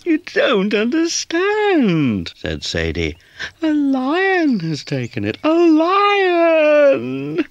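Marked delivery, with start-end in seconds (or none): 0.0-2.3 very high, slow kitten voice; upset little kitten pleading. A narrator's reading. Kitten insisting to Towser he doesn't get it. 3.6-7.5 very high, rising to loud crying; frightened cry. A narrator's reading. Kitten telling Towser a lion took her hat, frightened.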